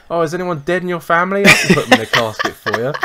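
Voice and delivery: a hint of a creepy funeral salesman at the door; mock funeral salesman. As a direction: pitch a casket like a hammed-up undertaker. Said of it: "I feel like a fucking funeral salesman".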